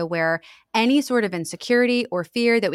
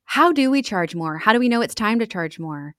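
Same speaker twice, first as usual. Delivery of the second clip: normal talking voice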